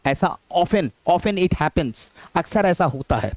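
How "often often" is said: often said "off-en", both times without T; silent T. Shown as a demonstration. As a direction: drop the T in often. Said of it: "टी इसके अंदर silent".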